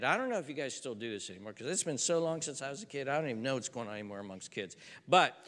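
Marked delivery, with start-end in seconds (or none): none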